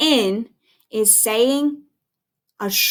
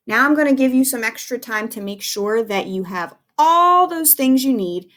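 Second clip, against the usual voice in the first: ordinary talk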